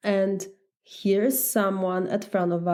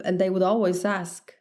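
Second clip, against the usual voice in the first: ordinary delivery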